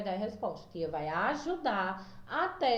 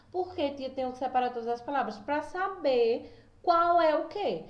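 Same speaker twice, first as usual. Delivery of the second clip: imitating a student; 4 semitones higher than usual. Student asking the teacher.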